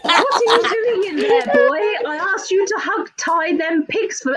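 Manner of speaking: high-pitched put-on character voice; someone else's voice. Reading out a message about pigs in his voice, high put-on character. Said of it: "Who wants to read it in his voice?".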